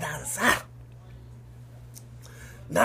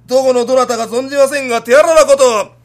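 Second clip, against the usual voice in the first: loud, high stage declamation; kabuki declaiming; 4 semitones higher, 13 dB louder than usual. Acted. Son playing kabuki against the clerk, loud declaiming. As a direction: ring it out like a kabuki hero.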